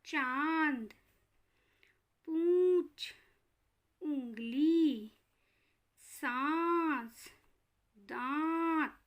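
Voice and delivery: each word drawn out slowly, vowels through the nose; teacher demonstrating nasalized words one by one; slow nasal examples. Shown as a demonstration. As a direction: spell out चांद, पूंछ, उंगली, सांस, दांत slowly with nasal vowels. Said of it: "तो हम इसके उदाहरण देखते हैं".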